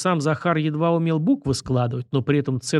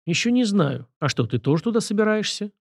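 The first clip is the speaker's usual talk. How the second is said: ordinary delivery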